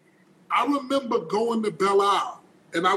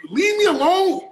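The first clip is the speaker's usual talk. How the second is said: mock phone brush-off; 4 semitones higher than usual. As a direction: shoo off a pretend caller. Pretend phone call joke.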